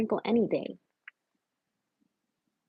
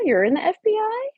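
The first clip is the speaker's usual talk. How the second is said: high, louder startled question; can't believe what you just read; surprised; 9 semitones higher, 4 dB louder than usual. Real, not acted. Repeats the question to Estella.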